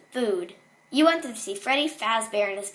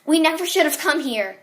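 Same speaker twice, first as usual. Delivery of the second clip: higher, louder alarm; pressing, raised voice; urgent mother; 5 semitones higher, 7 dB louder than usual. Acted. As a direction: blurt it as a worried mom rushing out. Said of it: "never heard such urgency in her voice".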